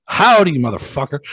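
loud, cocky hello with a sneer; mocking greeting. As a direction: greet him like you won. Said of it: "I'm like".

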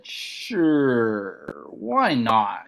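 drawn-out, reluctant agreement; slow inner yes. Retold or imitated. Comic agreeing in his mind to five minutes, slowly. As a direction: stretch it out as if talking yourself into it. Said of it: "I slowly, in my mind, said".

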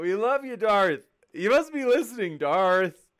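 high, loud playful shout-out; loud, high-pitched hail; calling out. Real, not acted. Host calls out to absent heckler.

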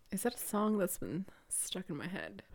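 hushed whisper to self. Real, not acted. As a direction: whisper it like a secret. Wonders aloud, unvoiced.

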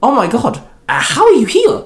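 high, loud astonished cry; shocked calling out. A narrator's reading. Indra calls out to her grandfather's apparition, shocked. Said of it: "called out, shocked".

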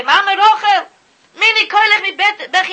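high, loud pleading call; retold cry to Rachel. Rabbi calling out to Mama Rachel, loud pleading. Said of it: "Reb Chaim Shmulevitz used to say".

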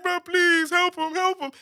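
high, drawn-out mock-tearful begging; hammed-up wailing appeal; crying plea. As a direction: imitate a crying kid pleading for help. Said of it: "and I'm crying".

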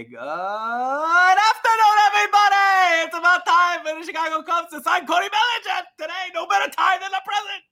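very high, shouted sports-radio rant; Mad Dog sign-off. Acted. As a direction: screech it out, drawing out the opening greeting. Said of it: "I'll do a Mad Dog sign off".

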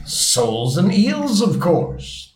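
high, drawn-out impatient answer; rather impatient. A narrator's reading. Griffin answers Alice's question, rather impatiently. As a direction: stretch it out as if it were obvious. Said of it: "replied rather impatiently".

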